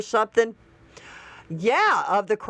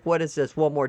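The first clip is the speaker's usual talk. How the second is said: normal talking voice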